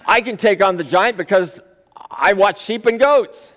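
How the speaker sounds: put-on lampoon the boy's flimsy argument; mocking imitation. Mocking David's weak argument.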